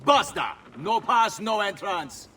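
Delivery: curt guard refusal. Acted. Guard turning travellers away without pass, sternly. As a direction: cut them off with an officious shout.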